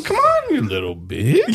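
witch voice. Acted. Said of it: "Mine's a witch".

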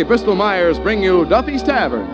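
usual speaking voice

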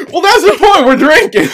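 real high-pitched, loud laughing exclamation; laughing shout. Real, not acted. Host to drinking friend, loud laughing shout. Laughing reply about alcohol taste.

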